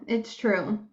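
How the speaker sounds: ordinary talk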